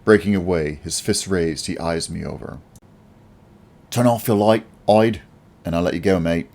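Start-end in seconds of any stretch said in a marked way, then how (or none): 3.9-6.5 put-on a threatening Australian gangster accent. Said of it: "accent you expect from a Australian gangster".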